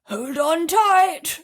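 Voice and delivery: put-on old grasshopper bellowing a slow warning to all; slow warning shout. A narrator's reading. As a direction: hold every word out as a loud warning. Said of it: "shouted the old grasshopper".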